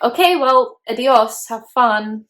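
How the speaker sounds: loud, mock-casual goodbye; breezy send-off. [name] waving off her roommate who moves out, breezy send-off.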